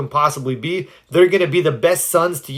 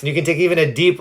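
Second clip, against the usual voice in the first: ordinary talk, nothing marked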